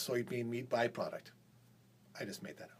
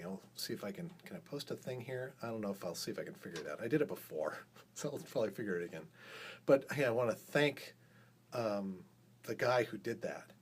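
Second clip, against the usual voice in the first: usual speaking voice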